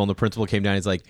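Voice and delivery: normal talking voice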